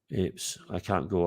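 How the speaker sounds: normal talking voice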